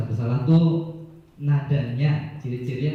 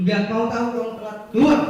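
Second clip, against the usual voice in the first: fast, high, angry shout ending louder on keluar; punishing teacher; 9 semitones higher, 5 dB louder, faster than usual. Acted. Punishing teacher throws out the late pupil, fast angry shout. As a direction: snap at the pupil and throw him out. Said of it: "Itu penghukum".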